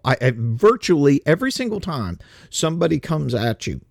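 ordinary delivery

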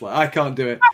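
normal talking voice

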